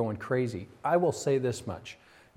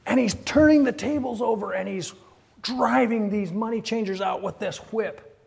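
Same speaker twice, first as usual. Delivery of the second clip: preaching at full force; 9 semitones higher than usual. Acted. Pastor preaching to his church at full force. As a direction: paint the temple scene with fire. Preaching Jesus clearing temple.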